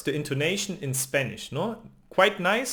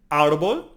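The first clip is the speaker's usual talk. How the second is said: "Árbol" with a strong, louder first syllable; stress on Árbol's first syllable; 7 dB louder than usual. Shown as a demonstration. Teacher presenting a Spanish word, first-syllable stress on "Árbol". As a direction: hit the AR in "Árbol". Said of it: "emphasize this syllable".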